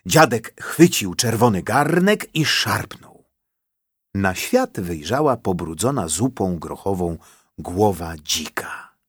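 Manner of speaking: ordinary delivery, nothing marked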